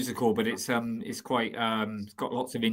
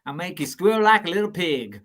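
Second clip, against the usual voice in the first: menacing hillbilly; 6 semitones higher, 4 dB louder than usual. Pretend. Friends doing accents, menacing southern hillbilly. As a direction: threaten like a hillbilly villain.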